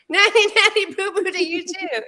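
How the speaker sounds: high singsong schoolyard taunt; childish taunt. Pretend. [name] teases Candy back with a high singsong kid's taunt. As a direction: tease like a little kid.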